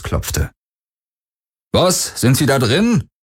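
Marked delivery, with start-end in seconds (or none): 1.7-3.1 higher, unsure henchman's voice; a timid guard calling through the door; nervous guard. Acted. Wache an Varidurs Tür. (unsicherer Wachmann, höhere Stimme)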